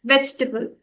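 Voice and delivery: "vegetable" as VEJ-tuh-bul; no long A at the end of "vegetable"; silent A. Shown as a demonstration. Teacher sums up the correction, reduced pronunciation. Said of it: "it's silent".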